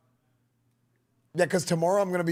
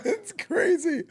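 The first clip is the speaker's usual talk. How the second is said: laughing; 12 semitones higher, much slower than usual. Real, not acted. Laughing at a looping clip.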